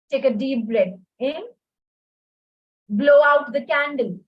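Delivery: guided breathing, strong blow; blowing out hard. Shown as a demonstration. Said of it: "let's do it with me".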